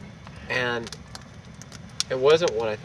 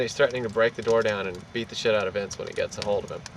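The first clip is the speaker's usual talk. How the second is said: ordinary delivery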